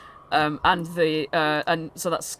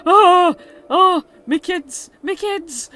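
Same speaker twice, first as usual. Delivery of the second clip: high, loud wail of relief; acted joyful disbelief on reunion; overwhelmed father; 13 dB louder, much slower than usual. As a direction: cry out for your children. Father acted, reunited with his children.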